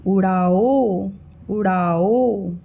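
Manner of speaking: slow, stretched-out syllables; slow reading aloud. Shown as a demonstration. Teacher reading slowly so kids can follow. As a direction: draw out उड़ाओ slowly for a child. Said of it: "म में ओ की मात्रा मौज".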